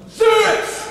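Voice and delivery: very high, loud yell; catchphrase shout. Pretend. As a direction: shout it out like a silly battle cry.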